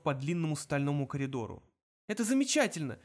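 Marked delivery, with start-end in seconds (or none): 2.1-3.0 high, strained sarcastic exclamation; strangled, high-pitched outburst; choked rasp. A narrator's reading. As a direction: force it out as if held by the neck. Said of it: "хрипел Артур".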